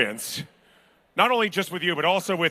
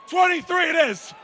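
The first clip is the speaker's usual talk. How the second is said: auction bid calling; 11 semitones higher than usual. Acted.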